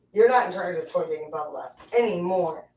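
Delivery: ordinary talk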